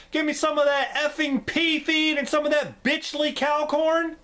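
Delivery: high, loud hammed-up fury; mock tantrum. Acting the nurse scolding her captive writer, high hammed-up fury. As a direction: rant like an unhinged nurse scolding.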